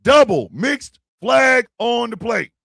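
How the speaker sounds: slow, loud referee announcement; hammed-up referee call. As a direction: bellow the penalty like a referee. Mock referee call, louder.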